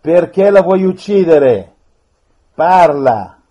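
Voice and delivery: loud, forceful orders; stern command. Real, not acted. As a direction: command it to speak. Priest commanding a spirit.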